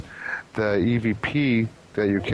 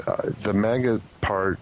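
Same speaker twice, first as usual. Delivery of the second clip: ordinary talk, nothing marked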